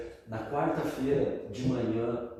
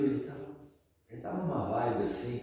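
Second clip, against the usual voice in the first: ordinary talk, nothing marked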